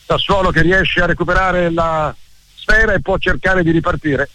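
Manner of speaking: normal talking voice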